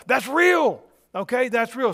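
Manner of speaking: loud, high preacher's cry; emphatic shout. Real, not acted. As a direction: hammer the point home, loud and high. Preacher building to emphatic point.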